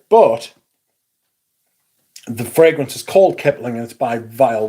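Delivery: usual speaking voice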